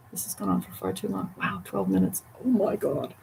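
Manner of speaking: quiet aside. Real, not acted. Muttered aside about video length.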